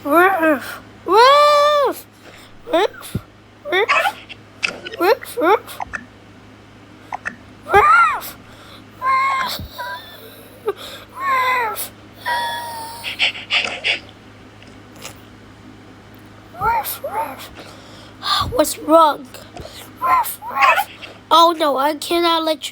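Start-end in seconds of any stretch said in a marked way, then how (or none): 0.0-5.8 high yelping puppy barks; dog barking. Pretend. 7.6-17.5 very high sharp puppy barks; dog barking. Pretend. Dog barking, then whimpering. 20.0-20.9 very high sharp puppy barks; dog barking. Pretend. Dog answers its owner.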